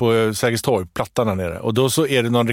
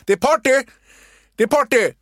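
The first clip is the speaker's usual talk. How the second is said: much higher mock shout; mascot shouting; 10 semitones higher, 3 dB louder than usual. Mascot chasing Erik up the stairs, high shouted call. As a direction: chase him with a high party call. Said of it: "börjar gå efter Erik".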